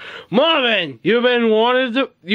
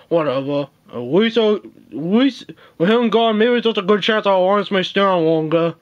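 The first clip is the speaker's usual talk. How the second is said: ordinary talk, nothing marked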